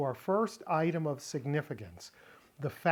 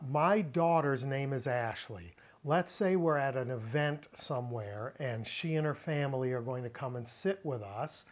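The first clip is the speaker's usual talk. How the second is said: ordinary talk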